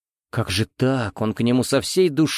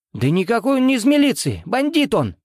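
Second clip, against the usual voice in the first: hysterical shouting; 12 semitones higher than usual. Acted. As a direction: yell in hysterical protest. Pasha protests hysterically.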